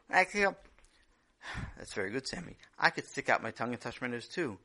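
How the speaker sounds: usual speaking voice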